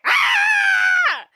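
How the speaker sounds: loud high-pitched mock scream; one long shrieked imitation of panic; freak-out scream. Woman freaks out in his apartment, mock panicked scream. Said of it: "she freaks out".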